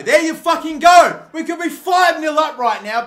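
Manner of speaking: high, loud, exasperated yell; angry outburst. Real, not acted. Fan fuming that it could be five nil, angry shout. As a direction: let the frustration burst out loud. Angry after opponents threaten to score.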